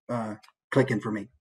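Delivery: ordinary talk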